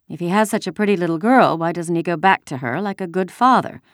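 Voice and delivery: plain reading voice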